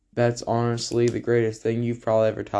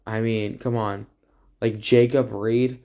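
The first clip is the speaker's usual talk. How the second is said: usual speaking voice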